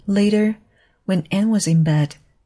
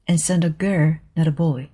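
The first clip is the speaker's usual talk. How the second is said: usual speaking voice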